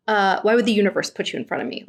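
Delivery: fast, dismissive imitation; mocking quote. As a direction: rush it off scornfully. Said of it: "she's like".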